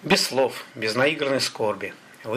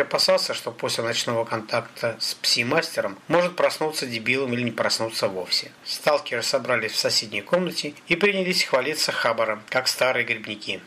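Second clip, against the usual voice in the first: ordinary delivery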